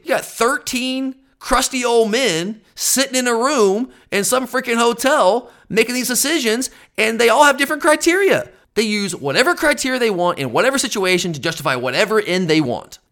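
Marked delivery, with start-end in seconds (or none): 0.0-10.8 high-pitched exasperated rant; sick of it. Real, not acted. Fan ranting about committee men in a hotel, fed up. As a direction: climb in pitch as the frustration builds. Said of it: "I'm sick of it".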